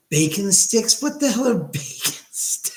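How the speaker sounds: high, incredulous repeat that breaks into a laugh; can't believe what he just read; surprised laugh. Real, not acted. Streamer reading a chat comment, laughing in disbelief.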